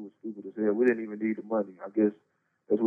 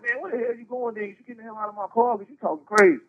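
angry; 11 semitones higher, 7 dB louder than usual. Retold or imitated. Cousin orders him out of car.

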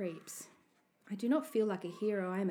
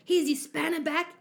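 raised, louder announcement; 8 semitones higher, 7 dB louder than usual. A narrator's reading. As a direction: say it loudly for others to hear. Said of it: "in a loud voice".

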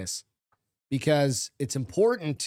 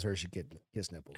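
quiet; 8 semitones lower, 14 dB quieter than usual. Real, not acted. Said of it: "teasing aside".

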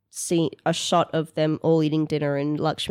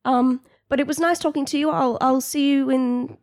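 higher, halting, polite employee; pitched-up, hesitant phone voice; nervous phone manner; 10 semitones higher, 5 dB louder than usual. Acted. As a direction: excuse yourself awkwardly from the call.

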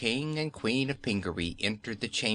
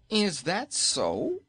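startled Nome King; 9 semitones higher, slower than usual. A narrator's reading.

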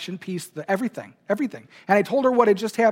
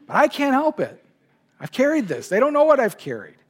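higher, pitch breaking with emotion; fighting back tears; welling up; 7 semitones higher than usual. Real, not acted. Telling the congregation why he cried, voice rising with emotion. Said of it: "I'm starting to cry".

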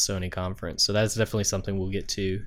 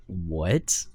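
acting out stunned confusion; baffled reaction; 4 semitones lower than usual. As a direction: let one puzzled word curl upward. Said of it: "we were like".

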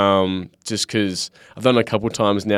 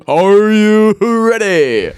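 drawn-out high announcer call; game show host; 11 semitones higher, 6 dB louder, much slower than usual. Acted. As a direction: hype it like a quiz host. Game-show style hype.

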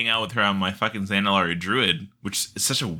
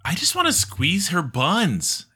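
ordinary talk, nothing marked

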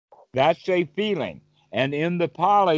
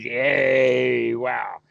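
mock crowd cheer; 4 semitones lower than usual. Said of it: "all the crowd jumps up and raises their hands and says".

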